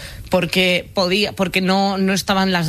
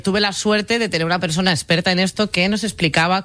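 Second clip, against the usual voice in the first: ordinary talk, nothing marked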